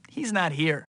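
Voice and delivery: ordinary talk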